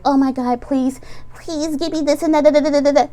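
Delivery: high, begging whine of someone praying for a sign; mock desperate pleading. Imitating an anxious fan begging the universe, mock desperate plea. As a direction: beg the universe in a put-on plea. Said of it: "and be like".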